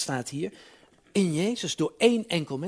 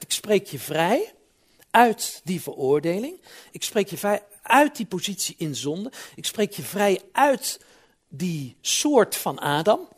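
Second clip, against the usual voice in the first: high, loud, slowed-down proclamation; fervent preaching; 5 semitones higher, 5 dB louder than usual. Acted. Voicing God's promise in sermon.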